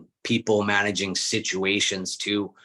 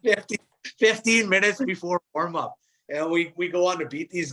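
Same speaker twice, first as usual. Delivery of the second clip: laughing; 9 semitones higher than usual. Real, not acted. Laughing at the punchline.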